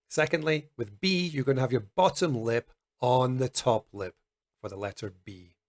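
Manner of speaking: ordinary talk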